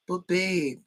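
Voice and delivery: high, whiny pleading; high-pitched pandering whine; mocking needy boyfriend. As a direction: beg like a clingy boyfriend. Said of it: "he's trying to pander to her".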